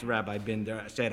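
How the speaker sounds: ordinary delivery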